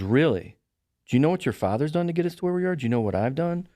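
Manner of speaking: imitating an indignant mother; rising, quick reproachful questions; retold scolding.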